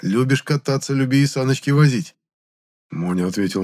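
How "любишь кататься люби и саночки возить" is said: злорадное высокое нравоучение; читай мораль с удовольствием; стервозно-нравоучительно. A narrator's reading. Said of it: "стервозно-нравоучительным голосом". (стервозно-нравоучительный голос)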